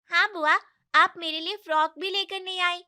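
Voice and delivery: put-on little girl sulking at aunt; child voice. Niece complaining to aunt.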